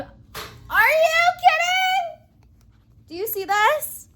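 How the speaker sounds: high, loud, gushing exclamations; thrilled. Real, not acted. Vlogger shows viewers her wonderful table, thrilled loud gushing. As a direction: brag about it with delight.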